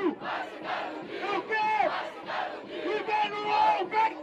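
high, drawn-out hype shouts; hyping the crowd. Real, not acted. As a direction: whip the crowd up before the round. MC whips up crowd before round. (gritos agudos de animação pra plateia)

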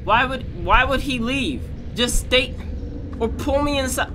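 high frustrated yelling. Real, not acted. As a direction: yell it high and betrayed. Yelling at partner who left.

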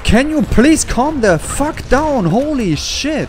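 high, pleading angry shout; furious yell. Real, not acted. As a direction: yell at them to knock it off, pitched high. Yelling at enemies throwing dynamite.

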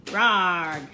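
loud mock roar from Barbara; shouted roar. A narrator's reading. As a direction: roar it out loudly. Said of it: "she shouted".

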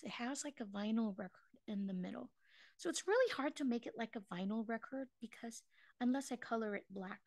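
ordinary talk, nothing marked

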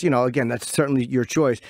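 ordinary talk

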